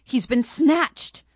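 panicked little creature pleading; distressed cry. Acted. Monkey begs the sisters to see her bear's plight, crying out. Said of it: "she cried".

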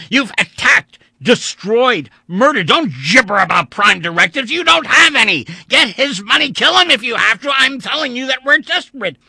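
very high, loud, raging tirade; furious shouting. Acted. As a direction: hurl every accusation. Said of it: "Vandeleur burst out".

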